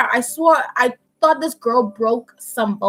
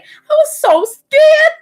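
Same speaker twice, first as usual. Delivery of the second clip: very high, loud, drawn-out mock wail; hammed-up scared wail; 7 dB louder, much slower than usual. Telling viewers about her injured friend, exaggerated scared wail. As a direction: stretch it into an exaggerated frightened squeal. Storyteller dramatizes her own fear.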